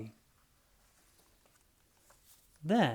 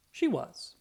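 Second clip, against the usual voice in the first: usual speaking voice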